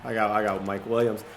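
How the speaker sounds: usual speaking voice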